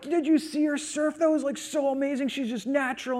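high-pitched gushing dad; re-enacted proud boasting, pitch way up; bragging. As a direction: rave about your kid to a friend. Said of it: "I'm just bragging about it".

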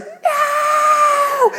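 long, very high-pitched wail; imitated child's scream. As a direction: hold a mock scream like a terrified five-year-old. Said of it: "poorest little five-year-old girl scream".

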